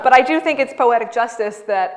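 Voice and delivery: ordinary talk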